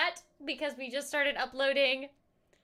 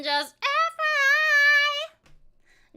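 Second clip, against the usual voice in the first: 1 high slow voice; 9 semitones higher, 4 dB louder, much slower than usual. Pretend. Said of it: "repeats phrase in voices".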